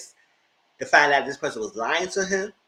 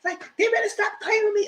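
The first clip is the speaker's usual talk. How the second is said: furious cursing. Retold or imitated. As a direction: rage at the catfish who lied. He rages about the catfish.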